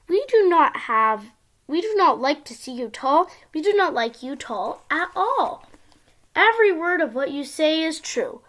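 sing-song storybook reading; reading to a toddler. Acted. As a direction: read it like a bedtime picture book. Child reads picture book aloud.

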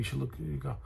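normal talking voice

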